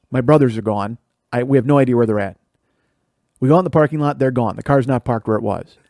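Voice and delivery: ordinary talk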